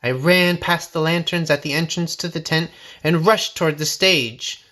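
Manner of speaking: usual speaking voice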